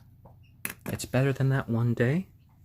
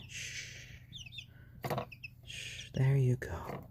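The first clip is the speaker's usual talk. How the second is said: soft unvoiced whisper; hushed whisper to a pet; 2 semitones higher, 10 dB quieter, slower than usual. Real, not acted. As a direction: breathe it to calm the chick. Calming chick.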